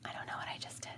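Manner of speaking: hushed, embarrassed whisper; mortified aside, whispered. Real, not acted. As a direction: breathe it out under your breath.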